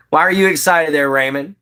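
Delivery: usual speaking voice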